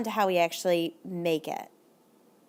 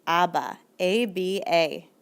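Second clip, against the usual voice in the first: slow spelled ending; 3 semitones lower, slower than usual. Shown as a demonstration. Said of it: "you're gonna add".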